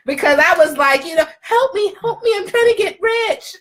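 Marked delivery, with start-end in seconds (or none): 1.4-3.5 high, drawn-out begging voice; mock pleading. Host messaging a celebrity for help getting rich, mock pleading. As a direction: plead high, playfully hamming up the need.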